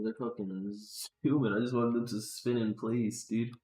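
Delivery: ordinary delivery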